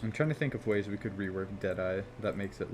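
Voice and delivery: usual speaking voice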